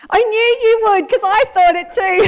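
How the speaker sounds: high, laughing confession; laughing admission. Real, not acted. Preacher laughing with the congregation at herself. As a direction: admit it through a laugh.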